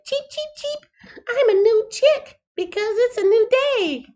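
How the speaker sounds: very high, tiny chirping voice; little chick. Acted. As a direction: peep it out like a newborn chick. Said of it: "It was meant to be like".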